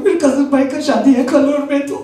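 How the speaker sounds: high, rapid Hindi pleading in a put-on heroine voice; Bollywood plea again. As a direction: rattle off the melodramatic plea again. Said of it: "I repeat the whole thing".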